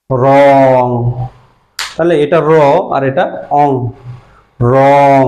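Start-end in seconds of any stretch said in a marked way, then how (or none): 0.1-0.9 "রং" held long and louder; pronunciation demo. Shown as a demonstration. Teacher shows learners how the sign sounds, "রং" drawn out. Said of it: "এটাকে আমরা কি উচ্চারণ করি?". 4.7-5.2 "রং" stretched into its closing ng; pronunciation demo. Shown as a demonstration. Teacher demonstrates the ong sound to learners, "রং" drawn out. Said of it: "তাহলে আমরা উচ্চারণ করতেছি অং".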